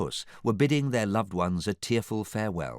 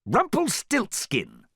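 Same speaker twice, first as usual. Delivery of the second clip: cross correction; 5 dB louder, slower than usual. A narrator's reading. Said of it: "he was corrected crossly".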